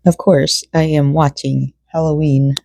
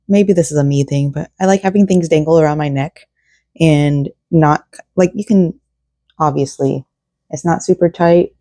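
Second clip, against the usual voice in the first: usual speaking voice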